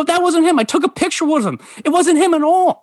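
high, urgent, insistent denial; retold protest. Setting his friend straight about the photo, acted urgent protest.

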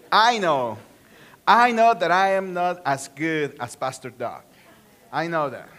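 usual speaking voice

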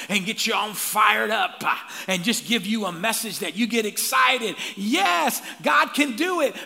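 loud, high hyped-up shout; hype preaching. Acted. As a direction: whip the crowd up like a motivational preacher. Mimics hype motivational preaching.

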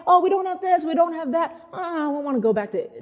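high, whiny complaint; grumbling. Retold or imitated. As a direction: imitate a discontented crowd whining. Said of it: "the people were grumbling".

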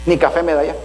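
low, sulky muttered grumble with a mocking laugh; the preacher's heart voiced as a wounded, grumbling husband; voice of the sinful heart. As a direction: play the self-pitying husband's inner voice, lightly. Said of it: "Mi corazón pecaminoso va a decir".

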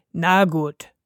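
sighed reluctant agreement; resigned sigh. A narrator's reading. The wolf gives in to the others, sighing. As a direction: give in with a small sigh. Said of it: "seufzte der Wolf".

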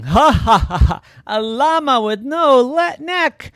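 loud, high mock laughter; hammed-up chuckling. Zeus mocking a neckless llama, hammed-up laughing. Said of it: "Big Zeus started chuckling".

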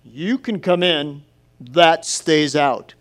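slow and firm at the door; stern old Smith. Retold or imitated. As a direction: refuse the newspaper coldly. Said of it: "Smith's standing in the doorway real stern".

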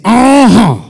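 preacher whipping up the crowd; shouted uh-huh. Shown as a demonstration. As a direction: belt it out.

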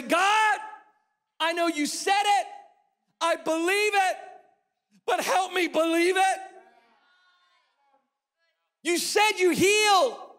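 high, loud pleading prayer; crying out in prayer. Acted. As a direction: cry out to God, building. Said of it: "cry out to Jesus today and say".

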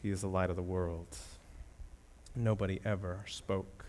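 hushed awe. Acted. Preacher quietly repeating the claim after the climax, in soft awe. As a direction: let it drop to a near hush.

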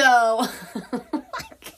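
bursting into helpless laughter; laughing too hard to go on; real giggle. Real, not acted. Retelling her stuck jaw and cracking up. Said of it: "I can't even tell this story".

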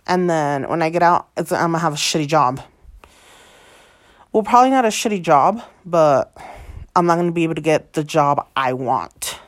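loud, fast, fed-up venting; heated rant. Real, not acted. Venting loudly to viewers about the coming felony. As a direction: get it off your chest, loud.

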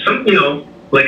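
ordinary talk, nothing marked

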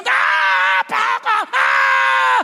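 high, loud cries of panic; acted screaming. Preacher acting out those screaming outside the house, high loud screams. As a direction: scream like someone caught outside. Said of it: "Habang nagsisigaw".

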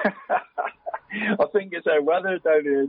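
answering through real laughter, slowly; laughing. Real, not acted. Podcast guest, teased about loving trees, laughing while answering. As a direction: laugh the words out, caught off guard.

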